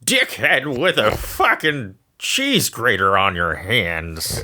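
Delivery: slow cartoon villain voice; mock Krang taunting Shredder; Krang impression. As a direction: sneer at Shredder like a cartoon brain alien. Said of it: "my Krang".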